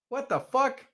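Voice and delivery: high-pitched rising question; baffled disbelief. Retold or imitated. Man in back of cop car, baffled disbelief. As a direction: let the pitch shoot up in bewilderment.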